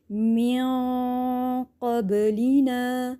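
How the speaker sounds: slow, chanted, nasal hidden nun; Quran recitation. Shown as a demonstration. Teacher recites slowly for the class. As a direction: draw out من قبلنا with the nun hidden in the nose. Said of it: "کیسے میں پڑھتی ہوں؟".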